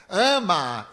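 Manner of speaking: a sharp, loud order of one word; commanding shout. Retold or imitated. The spirit ordering the speaker to love the child, loudly commanding. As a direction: bark the command at him.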